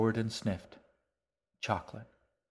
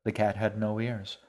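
plain reading voice

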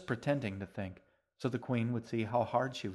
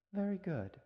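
warm praise; 9 semitones higher than usual. A narrator's reading. Queen praises the girl.

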